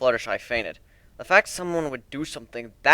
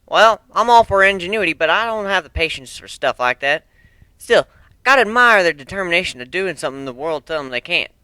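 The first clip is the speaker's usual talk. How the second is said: Applejack voice; 5 semitones higher, 7 dB louder than usual. Acted. Applejack summing up the machines to friends, loud country voice. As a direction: sum it up plainly like a farm pony.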